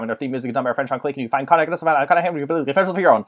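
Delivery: fast auctioneer patter. Pretend. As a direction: rattle it off like an auctioneer. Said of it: "every time I auctioneer".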